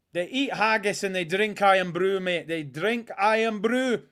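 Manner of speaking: mock Scottish patter; Scottish accent. Fan joking about haggis and Irn-Bru, mock Scottish accent. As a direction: put on a Scottish accent, drawing out the last words. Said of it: "Not a bad accent".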